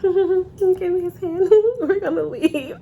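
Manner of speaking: a playful grab, laughing through it; laughing mock claim. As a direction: giggle it out like a possessive date. Kai voiced grabbing his hand.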